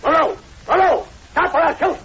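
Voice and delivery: loud, high commanding yells; shouting after him. Acted. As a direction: bellow at the running man to stop. Calling after fleeing man.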